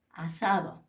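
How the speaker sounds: normal talking voice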